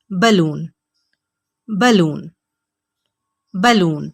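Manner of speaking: बलून with a short "buh"; बलून repeated slowly and clearly; correct pronunciation. Shown as a demonstration. Teacher drilling balloon for students. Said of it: "होता है".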